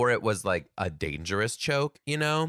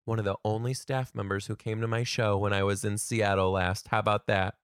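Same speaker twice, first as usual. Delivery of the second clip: normal talking voice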